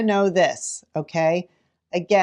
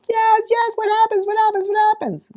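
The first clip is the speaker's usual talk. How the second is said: put-on much higher, louder kid voices; eager kids; 5 dB louder, faster than usual. Kids begging teacher to reveal the page, eager. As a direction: bounce like impatient children. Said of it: "they're like".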